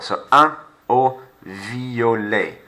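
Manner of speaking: "un O violet" slowly, violet as vee-oh-lay; careful model phrase to repeat; slow French model. Shown as a demonstration. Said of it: "Say to yourself".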